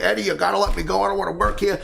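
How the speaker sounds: high, rapid-fire pleading; insistent high-pitched begging; pestering child. Retold or imitated. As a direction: nag like an impatient kid. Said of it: "like a child with his father saying".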